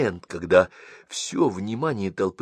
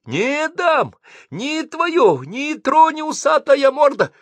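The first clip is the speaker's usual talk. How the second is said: much higher, frantic screaming; 6 dB louder, slower than usual. Acted. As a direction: shriek at the mustached sergeant. Landlady keeps screaming.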